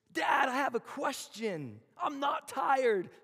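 put-on high, loud, drawn-out child's whine; little son. Son Judah calling to his dad, a high loud whine. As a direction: play the kid who won't sleep. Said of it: "out comes my son Judah".

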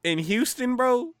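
high, loud "In Houston, bro?"; shocked disbelief. Real, not acted. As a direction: blurt it in disbelief. Stunned by the coach news.

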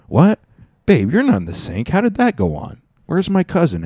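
high, loud baffled squeak; mock confusion. Hearing dishes in the sink, he asks the girlfriend in high mock confusion. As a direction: pitch up in disbelief, calling to girlfriend.

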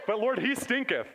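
archaic line through laughter; chuckling over he stinketh; laughing King James quote. Retold or imitated. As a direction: savour the old wording with a laugh. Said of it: "That's awesome".

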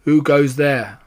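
usual speaking voice

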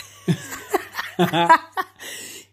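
real burst of laughter; laughing at her own annoying face; loud breathy laugh. Real, not acted. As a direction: laugh out at yourself.

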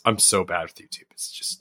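breathy whispered confession. Real, not acted. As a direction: whisper it to yourself. Self-deprecating aside.

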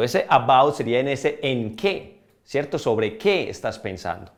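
ordinary talk, nothing marked

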